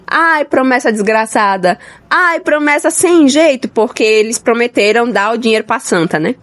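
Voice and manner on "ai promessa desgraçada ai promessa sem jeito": slow, drawn-out mock lament; João Grilo. Reader imitating a film character's complaint, mock lament. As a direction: stretch the words into a playful moan, rising on the second. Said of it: "ele fica falando assim".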